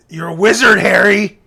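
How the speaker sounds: loud, proclaimed announcement; mock grand reveal. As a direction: announce it big, as the storybook giant. Acting the giant's big reveal.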